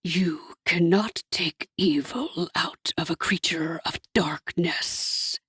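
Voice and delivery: low, slow whispered coo; a soft devil's whisper, slowed. A narrator's reading. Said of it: "Hasatan cooed".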